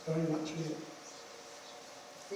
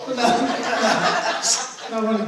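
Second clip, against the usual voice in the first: laughing; 3 semitones higher, 7 dB louder, slower than usual. Real, not acted. Laughs after joking about actor.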